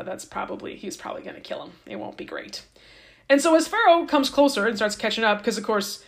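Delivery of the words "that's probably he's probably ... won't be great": hushed whisper of grim news; whispered aside. Real, not acted. Telling listeners Pharaoh will likely kill them, in a whisper. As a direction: drop to a whisper for the grim bit.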